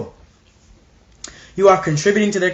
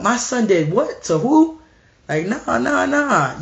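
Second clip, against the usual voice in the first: shocked parent, mock; 6 semitones higher than usual.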